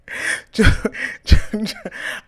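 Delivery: a quick airy chuckle; short breathy laugh. Real, not acted. Laugh between repeats.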